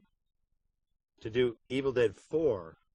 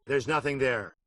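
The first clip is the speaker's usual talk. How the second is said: Ash game line; 3 semitones higher, 6 dB louder than usual. Shown as a demonstration. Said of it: "Ash will say".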